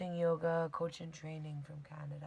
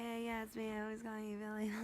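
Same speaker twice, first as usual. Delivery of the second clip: higher, bright phone hello; higher-pitched friendly phone greeting; chirpy phone greeting; 6 semitones higher than usual. Retold or imitated. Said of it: "I'm like".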